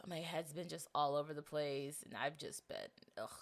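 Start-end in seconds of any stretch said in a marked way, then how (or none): none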